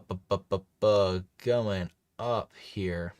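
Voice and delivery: ordinary talk, nothing marked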